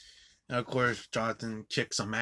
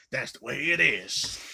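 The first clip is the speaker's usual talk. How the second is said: loud, raised mock-villain proclamation; emphatic villain quote; 4 semitones higher, 7 dB louder than usual. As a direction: punch it out as the villain's catchphrase. Villain crumples paper, repeats line.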